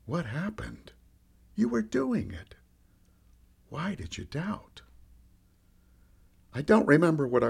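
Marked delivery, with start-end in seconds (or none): 0.1-4.8 soft, gentle reassurance; calm, very soothing. A narrator's reading. Jesus comforts the sinking disciple, calm and soothing. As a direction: ask it softly, soothing and unhurried. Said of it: "in this calm and very soothing voice".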